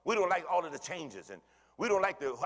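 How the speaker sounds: normal talking voice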